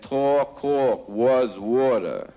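slow, drawn-out mock New York accent. Club owner hears a New Yorker, mocks his New York accent. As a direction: lay on a thick New York accent, slowly. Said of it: "So I recognize that accent".

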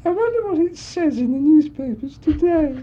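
high, silly showgirl voice; Amy MacDonald. Retold or imitated. Showgirl asking the audience about astrology, in a silly high voice. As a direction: squeak it out to the crowd. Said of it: "she'd say".

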